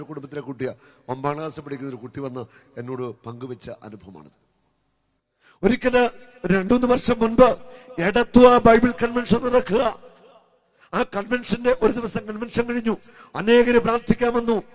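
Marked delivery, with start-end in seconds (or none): 5.6-14.6 loud, high, slow pulpit cry; a slow, loud sermon call; preaching at full force. Acted. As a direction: drive each duty home to the congregation. Preacher opens new testimony.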